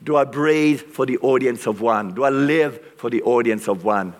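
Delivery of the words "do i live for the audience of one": ordinary talk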